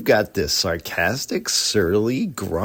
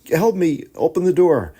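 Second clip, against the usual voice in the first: put-on higher and louder, urgent young girl; pleading girl; 8 semitones higher, 6 dB louder than usual. Evangeline urging the cat at the door, higher pleading girl voice. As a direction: beg the cat to open the door.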